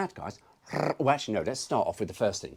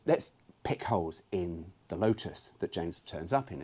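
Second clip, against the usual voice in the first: ordinary talk, nothing marked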